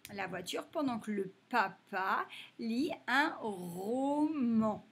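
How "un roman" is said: teacher drawing out the word; slow, stretched word. Shown as a demonstration. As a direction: draw out roman slowly. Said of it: "teacher stretching word for sound".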